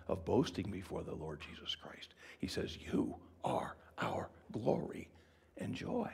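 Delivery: hushed awed whisper; reverent whisper. Acted. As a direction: breathe the words in awe. Whispered climax.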